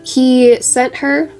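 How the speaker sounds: ordinary delivery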